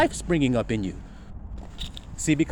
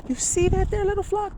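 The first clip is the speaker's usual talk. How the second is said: pitched way up, teasing the congregation; high calling out; 3 dB quieter than usual. Real, not acted. Preacher calls out high to his little flock.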